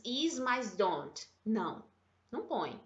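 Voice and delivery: ordinary talk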